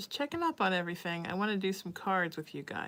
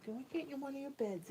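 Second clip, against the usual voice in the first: "Go get in one of your beds" voiced, higher, coaxing; 7 semitones higher, 9 dB quieter than usual. Real, not acted. Coaxing her cat into his bed, high soft baby talk.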